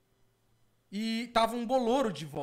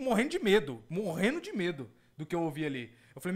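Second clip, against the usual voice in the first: ordinary talk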